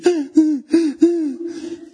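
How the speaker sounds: high, loud mock sobs; hammed-up crying in short bursts; acted sobbing. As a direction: burst into exaggerated wailing sobs. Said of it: "Ele estava chorando".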